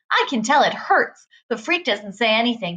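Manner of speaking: ordinary delivery, nothing marked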